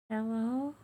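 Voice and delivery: high, faint little voice; weak small voice. Acted. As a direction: call out weakly, like a tiny creature. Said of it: "you hear a very weak voice".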